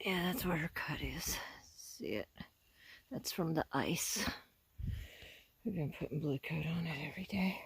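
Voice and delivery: whispering close to the animal. Real, not acted. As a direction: keep it to a hushed whisper. Whispering near the horse.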